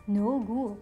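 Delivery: usual speaking voice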